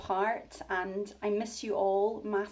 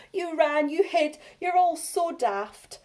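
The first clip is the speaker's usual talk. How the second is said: teasing; 8 semitones higher, 7 dB louder than usual. Acted. Bonehead teasing again.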